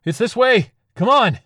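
high, loud call to follow; hurried, full-voiced shouting; urgent shout. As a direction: yell it to someone running behind you. Satanist leading a frantic escape.

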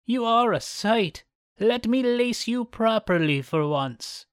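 high, slow scheming crone; old woman voice. Acted. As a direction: purr it like a wicked old hag. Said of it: "said the old woman".

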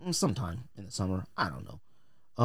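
ordinary talk, nothing marked

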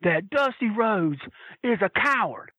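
high, slow, indignant; a hammed-up indignant how dare you; mock outraged lady. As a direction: scold like an offended fan. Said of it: "she goes".

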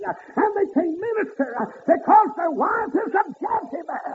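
high ringing preacher's cry; preaching at full force. Acted. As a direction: lift it up and ring it out to the congregation. Preacher whooping.

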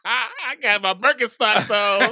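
high, loud, laughing joke. Real, not acted. Joking with his friend about his Birkenstocks, laughing hard. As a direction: crack himself up while bragging.

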